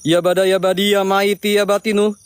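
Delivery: solemn ritual chant; summoning incantation. Real, not acted. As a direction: call out to the spirits. (mantra pemanggilan yang khidmat)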